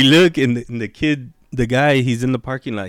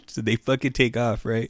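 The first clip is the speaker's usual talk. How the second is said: usual speaking voice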